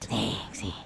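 low, soft teasing echo; sultry. Pretend. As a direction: purr it low. Echoing sultry tone.

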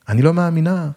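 high, rising squeal of disbelief; delighted screech. A narrator's reading. Old flame meeting returning lover on the beach, excited screech. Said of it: "היא צרחה ורקדה איתי".

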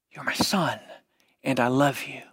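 low whisper to his son; tender whisper. Acted. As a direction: whisper it close to his ear. Father whispers to returning son.

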